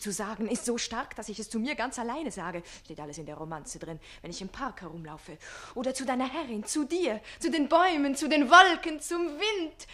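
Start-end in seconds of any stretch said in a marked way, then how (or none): 5.7-9.9 building higher and louder; rising rapture. Acted. Page describing his love, rising rapture. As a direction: let it swell with each phrase.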